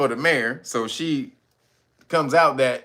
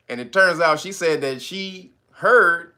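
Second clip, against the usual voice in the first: normal talking voice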